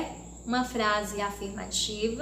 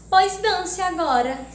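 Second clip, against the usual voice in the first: loud, higher mocking "Pois dance agora!" with a sharp rise on "Pois"; ironic exclamation; 6 semitones higher, 6 dB louder than usual. Shown as a demonstration. Teacher reading the ant's ironic line to children, mocking exclamation. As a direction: sneer it out like the ant to the cicada. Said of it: "eu estou exclamando uma ironia com a formiga".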